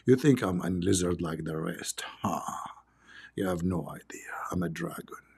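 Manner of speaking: slow, breathy lizard whispering low; hissing lizard, whispered low and slow; creepy lizard whisper. Acted. Lizard voiced in his story.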